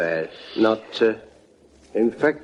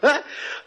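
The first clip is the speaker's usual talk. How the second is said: a silent, airy burst of laughter; airy laugh at Harry; breathy laugh; 10 semitones higher, 5 dB quieter than usual. Acted. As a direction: laugh under your breath.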